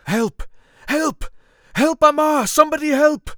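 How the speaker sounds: injured man staggers toward camp, shouting high and loud for help. A narrator's reading. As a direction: yell for help as if wounded and staggering.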